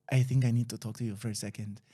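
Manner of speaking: a hint of a grave confidant pulling him aside; friend's boyfriend quietly breaking the news; low serious boyfriend.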